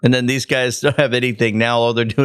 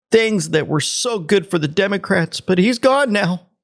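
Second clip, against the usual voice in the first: high, sing-song mock admiration; sarcastic high mock-gushing about the senator; sarcastic praise; 8 semitones higher than usual. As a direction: gush ironically about Cornyn.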